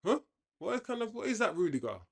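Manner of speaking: sudden high, puzzled outburst; can't believe what he just saw; real shock at a bad play; baffled disbelief. Real, not acted.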